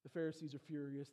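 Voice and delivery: usual speaking voice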